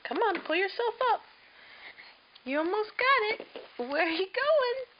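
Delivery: excited encouragement. Real, not acted. Mother cheering baby standing.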